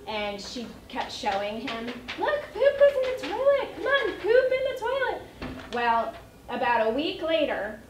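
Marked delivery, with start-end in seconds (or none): 2.2-5.2 put-on high, loud sing-song coaxing of a toddler. Mom coaxing her toddler in a high sing-song. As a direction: coax him like a cheerful cheerleader mom.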